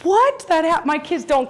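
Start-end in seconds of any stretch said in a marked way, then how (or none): 0.0-0.5 high, loud stunned "What?"; a disbelieving squeak of a question; shocked what. Retold or imitated. Mother stunned by other kids obeying, high surprised what. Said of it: "And I'm like".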